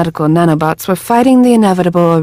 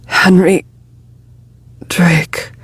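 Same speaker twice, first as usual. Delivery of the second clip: dying whisper; slower than usual. A narrator's reading. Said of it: "and died".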